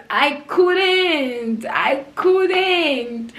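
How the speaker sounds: high, loud wailing; acted anguished wail. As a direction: draw it out in dramatised despair. Re-enacts breakdown during tearful confession.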